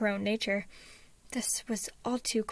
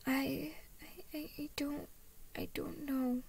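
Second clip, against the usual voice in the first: put-on soft, high, faltering child voice; whimpering; 5 semitones higher, 6 dB quieter than usual. A narrator's reading. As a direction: stammer it timidly, very small. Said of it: "he whimpered".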